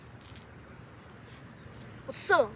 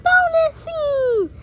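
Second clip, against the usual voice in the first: high, loud, drawn-out call; stretched, high-pitched title shout; announcing shout; 9 semitones higher, 11 dB louder, much slower than usual. Acted. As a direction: call it out slowly at full volume. Announces an extra scene.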